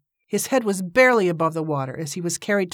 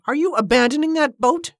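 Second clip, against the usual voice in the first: high-pitched indignant question; sharply rising demand; 9 semitones higher than usual. A narrator's reading. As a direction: challenge him with a raised, incredulous voice. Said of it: "Cornelia demanded".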